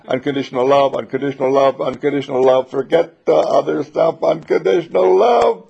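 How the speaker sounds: loud repeated chant, rising; emphatic mantra. Acted.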